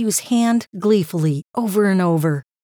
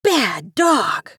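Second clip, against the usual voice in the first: angry scolding, only mildly raised; angry yell; 2 semitones higher, slower than usual. A narrator's reading. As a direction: scold the dog with a restrained, acted yell. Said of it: "Matthew angrily yelled".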